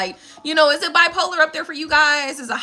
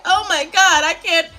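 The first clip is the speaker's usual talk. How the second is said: mock shivering Floridian; 8 semitones higher, slower than usual. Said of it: "forty-five degrees is like".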